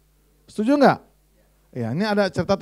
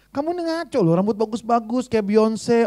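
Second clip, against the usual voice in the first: ordinary talk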